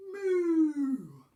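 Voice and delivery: one loud, long falling moo; cow moo. A narrator's reading. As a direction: let out a single big drawn-out moo. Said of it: "All she heard was".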